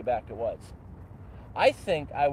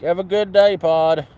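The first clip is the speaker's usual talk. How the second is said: hammed-up Canadian; 3 semitones higher, 10 dB louder than usual. Signs off in Canadian act.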